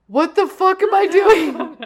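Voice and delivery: laughing. Retold or imitated.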